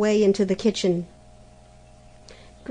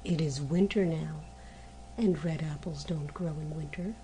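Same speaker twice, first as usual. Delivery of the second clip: put-on lower, softer old man's reply; old January; 4 semitones lower, 8 dB quieter than usual. Old January telling the girl quietly. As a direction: answer gently, deep and low. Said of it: "answered January".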